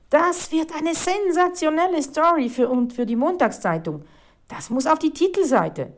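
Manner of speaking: high, loud, thrilled delivery; bursting with enthusiasm. A narrator's reading. Reporter planning a sensational front-page story, thrilled. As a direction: gush as if hopping with glee. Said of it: "Die Reporterin hüpfte vor Begeisterung".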